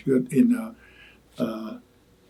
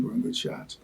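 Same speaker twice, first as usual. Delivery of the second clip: they weren't good shots whispered, much softer; whispered aside; 12 dB quieter, faster than usual. Real, not acted. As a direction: drop to a confiding whisper. Honest aside, repeated.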